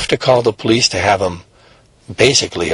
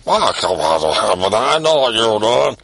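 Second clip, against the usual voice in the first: stern dad. Acted. Dad voiced scolding son.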